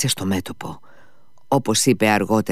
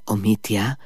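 ordinary delivery

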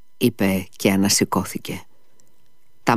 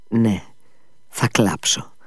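whispered promise; 4 dB quieter, slower than usual. Acted. Tearful promise to Liza.

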